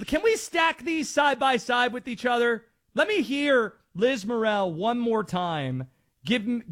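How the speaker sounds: normal talking voice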